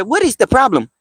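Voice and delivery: high-pitched Egyptian-accented imitation; imitating Egyptian woman. Retelling an Egyptian lady's question about the shopping fuss, imitated Egyptian accent. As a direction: quote her puzzled question in an Egyptian accent. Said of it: "That's how she talked".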